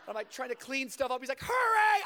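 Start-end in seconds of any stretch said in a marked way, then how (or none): 1.4-2.0 high, loud hurry-up shout; urgent yell. Retold or imitated. Friend outside the door shouts high and loud. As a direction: bang out the word.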